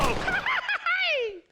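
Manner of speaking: very high, loud cackling laugh; loud drunken cackle; wild laugh. Acted. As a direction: cackle with glee.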